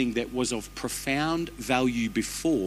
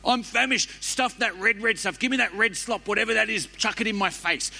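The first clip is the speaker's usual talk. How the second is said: much higher, pleading and frantic; frantic Esau; 9 semitones higher than usual. Acted. Preacher acting Esau begging for red stew, high and frantic. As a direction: gasp it out like you're dying of hunger.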